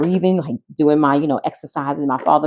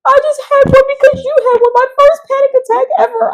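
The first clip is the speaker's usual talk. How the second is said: very high, loud, put-on voice; imitating a shaken dad; dad's message; 6 dB louder than usual. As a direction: read the dad's text in a high silly voice. Father's text read in voice.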